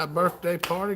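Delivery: usual speaking voice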